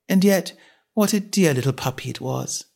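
fond Alice. A narrator's reading. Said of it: "said Alice".

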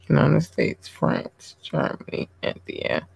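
breathy whisper, barely voiced; a secret roll call, whispered; whispered list. Shown as a demonstration. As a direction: whisper the country names. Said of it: "That's what it sounds like".

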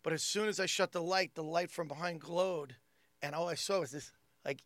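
ordinary talk

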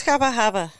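put-on echoed Boston accent, r's dropped. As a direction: mimic the Boston pronunciation. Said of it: "has a heavy Boston".

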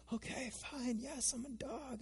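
tiny, high, very soft voice; small voice of mock submission; meek, submissive. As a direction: give in passively, barely audible. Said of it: "meekly and passively and submissively".

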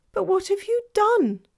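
high, loud anxious question; alarmed child. A narrator's reading. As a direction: ask in a worried little girl's voice. Child alarmed by faun's confession.

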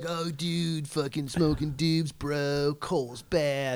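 hippie voice. Retold or imitated. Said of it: "That's our best hippie impression".

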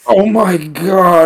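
high, slow, loud mock excitement; excited shriek. Real, not acted. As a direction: squeal it out in delight. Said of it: "Raid Shadow Legends".

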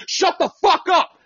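high, angry snap; exasperated outburst. Real, not acted. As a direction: cut the bickering off in fury. Snaps at imagined bickering.

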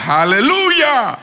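high, drawn-out shouted hallelujah; full-force preacher's cry; loud praise shout. Shown as a demonstration. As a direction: belt it out so the congregation answers hard. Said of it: "Yo quiero que se oiga duro".

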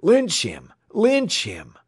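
loud, high angry-mob yell; high, loud chant of pursuers; mob cry. A narrator's reading. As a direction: shout it like a lynch mob. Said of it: "rose the cry".

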